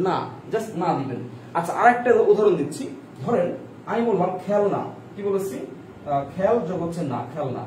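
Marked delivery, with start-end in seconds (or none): none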